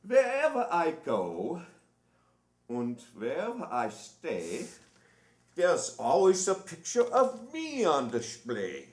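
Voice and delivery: lightly declaimed verse reading; poem recital. Acted. Impersonator reading Einstein's poem to the crowd, declaiming.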